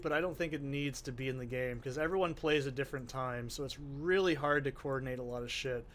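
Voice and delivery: ordinary talk, nothing marked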